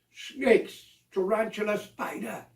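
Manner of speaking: usual speaking voice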